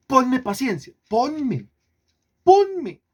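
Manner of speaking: high, pleading mock prayer, rising to a yelp; mock a believer begging God for patience; whiny plea to God. Preacher mimics believers praying, high whiny begging. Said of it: "le piden a Dios".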